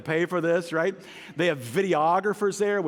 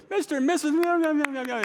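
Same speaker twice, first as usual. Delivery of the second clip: flustered fumbling; 11 semitones higher, 3 dB louder, faster than usual. Retold or imitated. Fumbling a name at wedding.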